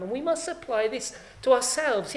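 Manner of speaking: usual speaking voice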